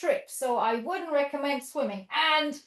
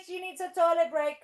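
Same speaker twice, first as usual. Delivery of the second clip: ordinary talk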